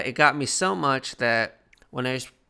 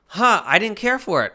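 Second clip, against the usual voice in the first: dismissive; 8 semitones higher, 7 dB louder than usual. Real, not acted. Dismissive reaction to movie.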